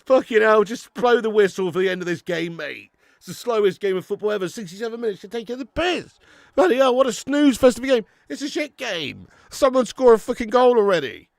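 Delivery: high-pitched, loud fed-up complaining; exasperated rant. Pretend. Streamer fed up with a snooze fest, high loud rant. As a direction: rail at a boring match, pitched right up. Mock rant at a dull game.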